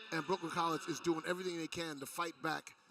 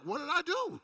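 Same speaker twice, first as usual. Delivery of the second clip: squeaky high-pitched whine of disbelief; mock player protest; 11 semitones higher, 4 dB louder than usual. Color commentator mimics Sarah James protesting the call, high mock whine. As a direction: play the fouled player pleading innocence, lightly. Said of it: "Sarah's like".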